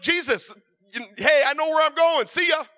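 loud, carefree goodbye to the world; a bright, fearless see-you-later; cheerful defiant farewell. Retold or imitated. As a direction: shout it off like you're ready to go. Fearless farewell facing a gun.

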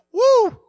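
loud, very high "woo"; amazed whoop. Retold or imitated. Acted amazement at God showing up.

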